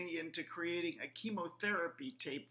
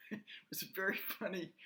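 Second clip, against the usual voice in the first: laughing; 4 dB quieter, much faster than usual. Real, not acted. Amused aside at her own story.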